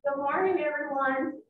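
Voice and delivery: muffled through a face mask; behind a face mask. Real, not acted. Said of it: "Can you hear me with the mask up?".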